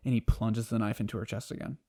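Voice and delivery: ordinary delivery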